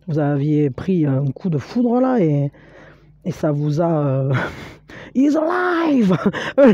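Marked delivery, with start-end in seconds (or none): 5.1-6.8 high, slow triumphant shout of "He's alive!"; hammed-up mad-scientist howl through laughter; mad scientist cry. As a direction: crow it like Frankenstein's doctor watching his creature wake. Mad scientist movie quote.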